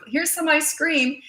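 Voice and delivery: put-on high, slow, sugary bribe to a child; coaxing parent. Parent diverting a naughty kid with candy, high coaxing. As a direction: lure the kid away with candy, sing-song. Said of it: "You always divert with".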